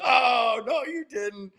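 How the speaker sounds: high-pitched mock-scandalized reaction; mock group gasp. Retelling the whole team's reaction.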